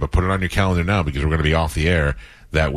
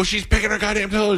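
high-pitched mock outrage; exasperated complaint. Acted quarrel, complains to wife.

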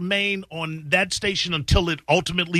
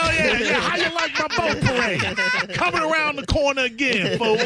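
laughing taunt; 10 semitones higher than usual. Real, not acted. Mocking boat parade, laughing.

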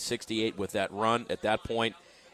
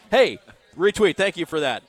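excited shout; 7 semitones higher, 10 dB louder than usual. Real, not acted. Cheering a crowd reaction.

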